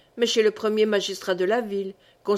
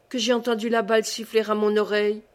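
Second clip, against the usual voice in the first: plain reading voice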